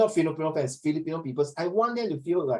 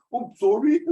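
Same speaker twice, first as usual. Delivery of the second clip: high, loud mock apology; acted oops-sorry, rising at the end; startled apology; 6 semitones higher, 6 dB louder than usual. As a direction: pop up high as if caught out.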